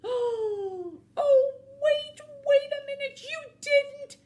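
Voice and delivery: very high, loud wail; frantic wolf realizing the betrayal; horrified disbelief. Acted. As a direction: cry out aghast, dragging the last words. Wolf realizes chickens were eaten.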